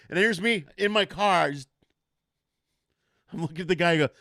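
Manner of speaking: normal talking voice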